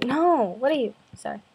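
ordinary talk, nothing marked

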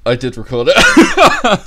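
laughing loud and slow; gleeful laughing brag. Real, not acted. Laughing after the fart joke.

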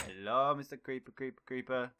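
ordinary talk, nothing marked

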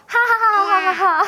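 high-pitched, drawn-out ha-ha laugh; pretend laugh. Teacher acting out "so funny" for pupils, mock laughing. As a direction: act out a big laugh, syllable by syllable.

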